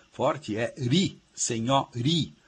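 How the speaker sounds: ri in "senhori" drawn out slowly and stressed; slow stress demo. Shown as a demonstration. Professor showing listeners the strong syllable, slow and stressed. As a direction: spell out "senhori" slowly, leaning on ri. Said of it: "a sílaba mais forte".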